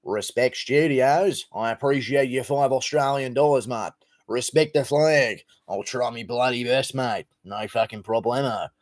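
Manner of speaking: broad mock Australian mate-talk; Australian accent. Thanking an Australian donor, mock Australian accent. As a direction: put on a broad Australian twang. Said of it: "my Australian brother".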